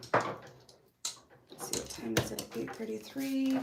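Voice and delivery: usual speaking voice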